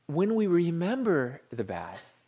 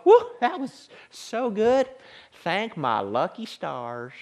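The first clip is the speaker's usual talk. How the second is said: slow, drawn-out Southern imitation; Southern accent; 2 semitones higher, slower than usual. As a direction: play a delighted friend with a Southern accent. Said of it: "She kinda had this Southern accent".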